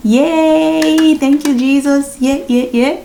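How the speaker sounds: drawn-out high cheer of relief; jubilant cheer. Real, not acted. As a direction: celebrate loudly, stretching out the first cry. Celebrating finishing the bachelor's degree.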